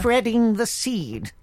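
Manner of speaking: high, very slow, prim royal voice; stretched-out regal euphemism; the Queen. Acted. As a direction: pronounce it delicately as a mock monarch. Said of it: "the Queen said".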